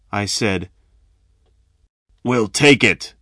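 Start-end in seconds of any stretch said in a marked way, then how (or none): 2.2-3.1 short snapped claim. A narrator's reading. Big robber grabbing the chicken, snapped. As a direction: snap it dismissively. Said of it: "the big one snapped".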